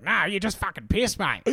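hammed-up Aussie brush-off; mock dismissal. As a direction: wave the drunk off. [name] dismissing the drunk.

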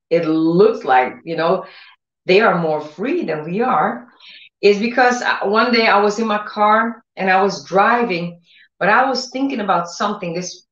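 ordinary talk

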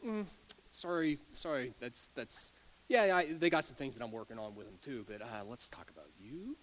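a quiet knowing nudge, turning it back on you; Holy Spirit. Retold or imitated. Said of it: "that's what the Holy Spirit would say".

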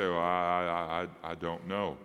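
low, halting mock answer; stumped hesitation. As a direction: stammer it like someone who has no idea. Acts out a stumped listener's answer.

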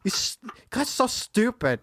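slow, high, breath-heavy German imitation; exaggerated German exchange student; German accent. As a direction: draw it out in mock German English. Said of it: "his broken English was, like, my favorite accent".

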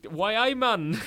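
put-on high, loud Geordie accent with laughter. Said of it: "I'm never doing a Geordie accent ever again".

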